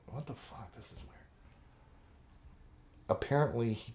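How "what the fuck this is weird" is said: baffled mutter. Retold or imitated. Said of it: "So I'm like".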